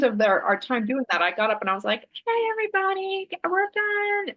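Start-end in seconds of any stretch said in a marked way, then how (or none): 2.2-4.3 high, singsong call at ordinary volume; ineffectual crowd call. Retold or imitated. Workshop leader trying to gather an unheeding room, ineffectual crowd call. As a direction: imitate yourself vainly calling a group to order. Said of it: "Nobody heard me at all".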